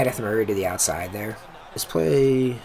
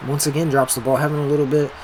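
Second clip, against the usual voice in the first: usual speaking voice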